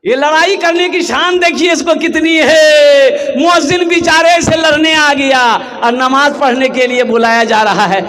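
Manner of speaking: high, ringing rebuke; indignant preaching. Real, not acted. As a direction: raise the pitch in scandalised reproach. (ऊँची आवाज़ में फटकार)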